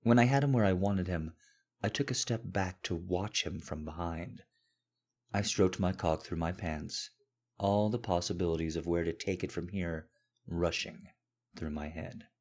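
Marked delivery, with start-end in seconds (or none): none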